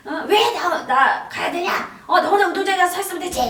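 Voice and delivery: loud, high-pitched angry mother; mother yelling. Retold or imitated. Mother refusing her child on school entrance day, yelling. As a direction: yell it as an exasperated mother, fast at the end. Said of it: "엄마가 막 소리를 지르시면서".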